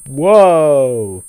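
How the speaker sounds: loud, high startled cry; startled whoa. Real, not acted. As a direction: react as if struck. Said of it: "player reacts to a hit".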